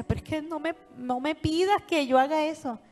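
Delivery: retold tearful plea. Husband begging his dying wife, mildly tearful plea. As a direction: beg her not to ask this, lightly tearful. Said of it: "él llorando decía".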